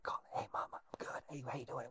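hushed secret phone whisper. Retold or imitated. Recruit sneaking a call home in the dark, whispering. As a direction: whisper so nobody in the barracks hears.

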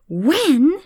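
high, loud agitated echo; agitated jackal. Acted. Jackal frustrated with the giraffe, agitated high echo. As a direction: snap it back, exasperated. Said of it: "the jackal is more agitated".

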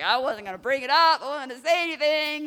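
high, loud hammed-up protest; mock-defensive shouting; imitated defensive husband. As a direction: play the husband frantically excusing himself. Acted defensive husband, raised voice.

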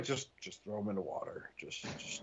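hushed, breathy exasperated mutter. Pretend. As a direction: mutter it under your breath. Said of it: "Prime's like".

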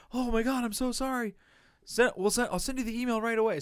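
high, fast, gushing fake apology; mocking the agent lady. Imitating the agent rushing to apologise, high and gushing. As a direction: parody her over-the-top sorry. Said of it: "I said".

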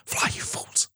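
a hushed whisper of Gandalf's last words; whispered Gandalf line. Retold or imitated. The friend whispers Gandalf's farewell line, hushed. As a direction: breathe it out like a fading wizard.

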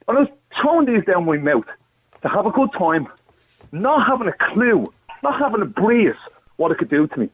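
fighting back emotion as he remembers; real distress rising in the voice; getting upset. Real, not acted. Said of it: "I'm getting upset here".

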